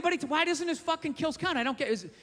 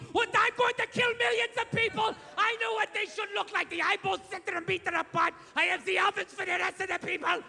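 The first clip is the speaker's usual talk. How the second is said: ranting dictator; 5 semitones higher than usual. Retold or imitated. Hitler's first rally speech to the crowd, high shouted rant. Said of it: "please welcome Adolf Hitler".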